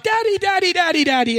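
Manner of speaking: put-on high-pitched child calling for Dad, dropping at the end; mock eager little-kid call; excited young child. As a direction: imitate a kid bursting in on Dad. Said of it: "It's my first child".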